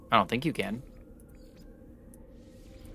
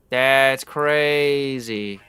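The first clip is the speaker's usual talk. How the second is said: slow drawn-out; 3 semitones higher, 4 dB louder, much slower than usual. Real, not acted. Drawn out disbelief.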